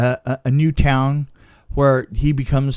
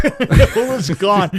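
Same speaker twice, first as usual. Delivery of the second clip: laughing; 6 semitones higher, 5 dB louder, much slower than usual. Real, not acted. Laughing at the house vanishing.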